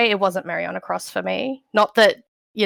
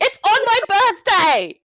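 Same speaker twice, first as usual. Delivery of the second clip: excited squeal; 11 semitones higher, 4 dB louder than usual. Real, not acted. Overjoyed fan, tour date news.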